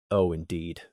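plain reading voice

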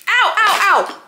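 sharp high ouch cries; pained yelps. Real, not acted. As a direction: yelp from a sudden burn. Burnt finger on twinkle lights.

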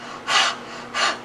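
worn-out breathy panting; heavy voiceless breaths after running. Acted. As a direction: pant like an exhausted animal. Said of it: "panting, he stops".

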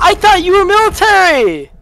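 high, loud, fast outburst; panicked shout. Real, not acted. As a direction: yell it in shock. Shocked outburst in game.

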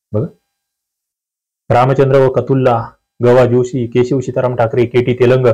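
usual speaking voice

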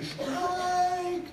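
high, drawn-out umpire call of strike one; umpire's call. Retold or imitated. Umpire calling the pitch at Yankee Stadium, high ringing call. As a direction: sing out the call like an umpire behind the plate. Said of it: "and you hear".

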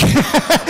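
high burst of real laughter; big laugh. Real, not acted. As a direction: laugh out loud, high and bright. Speaker laughs at own story.

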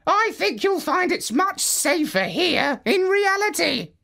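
a calm, high parrot giving advice. Acted. Parrot tells Marlowe to remove goggles, high bird voice. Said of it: "said the parrot".

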